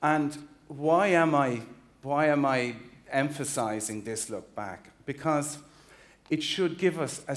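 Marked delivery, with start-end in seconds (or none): none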